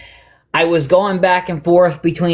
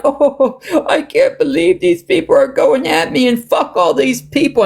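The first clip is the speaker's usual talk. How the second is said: mock sobbing complaint, much higher; imitating crying; 8 semitones higher than usual. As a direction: ham up a crying man ranting. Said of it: "he was crying on there like".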